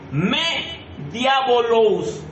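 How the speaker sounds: the Greek words drawn out syllable by syllable; very slow. Shown as a demonstration. Preacher reading the Greek in slow motion to the congregation. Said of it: "te lo voy a leer en cámara lenta".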